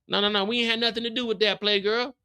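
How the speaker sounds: a fast no no no under threat; panicked denial. Retold or imitated. Snaps denies to Jukebox at gunpoint.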